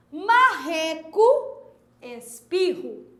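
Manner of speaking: Marreco, espirro said slowly, the RR a clear guttural h sound; teacher stretches out marreco and espirro to show the RR; slow demo of RR. Shown as a demonstration. Teacher to pupil, slow clear demo of the RR sound. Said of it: "Preste atenção no uso de R e de R R".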